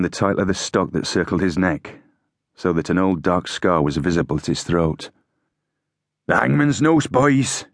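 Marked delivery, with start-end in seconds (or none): 6.3-7.6 raised, higher-pitched cry to the men. A narrator's reading. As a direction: cry it out to the troops. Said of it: "he cried".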